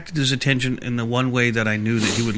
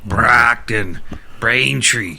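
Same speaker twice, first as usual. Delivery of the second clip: fake Boston accent; much slower than usual. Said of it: "in that fake Boston accent".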